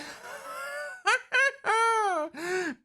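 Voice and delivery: high unhinged cackle; maniacal laugh. Acted. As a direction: cackle like a madman. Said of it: "Liotta laughs maniacally".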